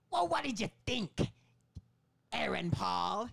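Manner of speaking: high, loud cackling goblin man; goblin Willem Dafoe voice. Retold or imitated. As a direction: squeal it like a gleeful goblin. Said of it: "he's always just a hundred percent the goblin".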